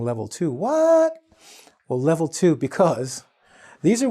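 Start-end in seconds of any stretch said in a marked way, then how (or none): 0.0-1.2 puzzled. Pretend. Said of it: "you're saying".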